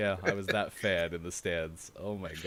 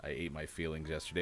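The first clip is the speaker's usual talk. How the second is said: ordinary talk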